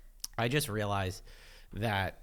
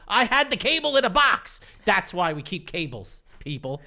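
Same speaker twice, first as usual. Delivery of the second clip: exasperated; 10 semitones higher, 9 dB louder than usual. Real, not acted. Venting about the broken cable.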